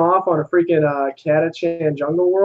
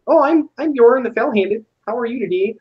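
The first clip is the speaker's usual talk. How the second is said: higher, cheery mock Nordic accent; 6 semitones higher than usual. Space Wolf Bjorn introduces himself in a mock Nordic accent. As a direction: greet them like a jolly Nordic warrior. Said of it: "They sound like, uh, Swedes or Canadians".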